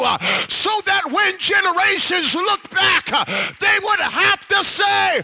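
loud, high preacher's cry building to a roar; preaching at full force. Acted.

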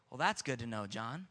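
low, soft aside after relief; relieved, dropped-voice reply; acted relief. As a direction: settle down, low and quiet. Questioners relieved at John's answer.

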